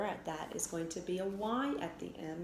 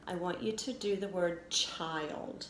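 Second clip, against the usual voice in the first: usual speaking voice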